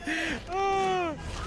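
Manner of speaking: high, soft laughter; laughing. Real, not acted. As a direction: crack up laughing on air. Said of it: "Why are you laughing?".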